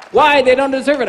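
protest, outraged; a believer objecting to Jesus about the undeserving; indignant believer. Acted.

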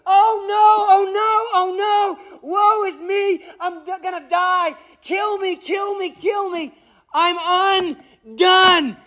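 high, panicked cries of doom; terrified wailing. Acted. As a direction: wail in terror as if about to die. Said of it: "His reaction is".

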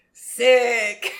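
loud, drawn-out mimicry; mocking imitation. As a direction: exaggerate it like teasing friends. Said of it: "they'll all be like".

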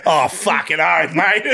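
slow, hammed-up Aussie twang; exaggerated Australian accent with laughter; Crocodile Dundee-style Australian accent. Shown as a demonstration. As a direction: stretch out a broad Australian, amused. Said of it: "you gotta Dundee it up".

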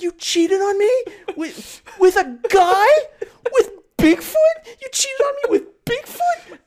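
very high, loud wailing disbelief; hysterical betrayed wife. Acted. Wife learning husband cheated with Bigfoot, mock hysteria.

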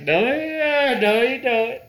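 high, squeaky no you don't; high mock refusal. Retelling snatching the knife back, squeaky high refusal. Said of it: "I'm like".

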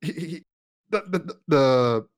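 stumbling over the words; stuttering. Real, not acted. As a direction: repeat the words, stuck. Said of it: "excuse the weird stuttering there".

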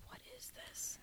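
whispered, as if to oneself; hushed, incredulous whisper; baffled whisper. Retold or imitated. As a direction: retell a puzzled reaction under the breath. Retold baffled reaction while watching.

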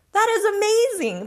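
high, loud amazed cry; thrilled exclaim. Retold or imitated. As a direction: blurt it out in sheer wonder. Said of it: "I was like".

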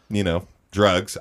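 ordinary talk

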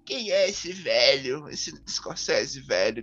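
lightly mimicked whiny fanboy, drawn out slowly; slow mocking nerd quote; mock snotty nerd. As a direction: imitate a clueless nerd, dragging the words. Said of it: "muitos aqueles nerds bem remelentos".

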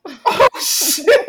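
loud, high, laughing shock. Retold or imitated. Reacting to the stepdad's threat of the belt, shocked and laughing. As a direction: blurt it out through laughter.

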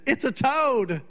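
high, alarmed announcement; imitating a frightened wife. Wife telling husband what's on the doorstep, imitated high alarmed voice. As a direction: squeal it like it's a monster. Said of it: "she looks and she said".

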